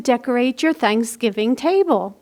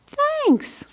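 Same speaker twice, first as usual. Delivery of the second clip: bear's thanks; 14 semitones higher, 4 dB quieter than usual. Acted.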